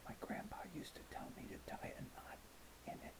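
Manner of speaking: hushed secret whisper; teacher whispering a private tip to the boy. Acted. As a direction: lean close and whisper it in his ear. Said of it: "whispered in my ear".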